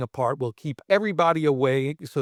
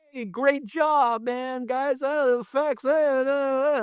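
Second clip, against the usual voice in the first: much higher, slow halting Jim Carrey voice; awkward Jim Carrey; 9 semitones higher, slower than usual. Retold or imitated. Jim Carrey awkwardly praising the effects guys, high and halting. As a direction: stumble through the praise like him. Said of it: "he came over and he went".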